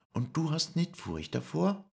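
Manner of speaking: very high, doubtful question; Lisei's voice. Acted. Lisei asks Paul if he is afraid, very high voice. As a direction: ask high and unsure.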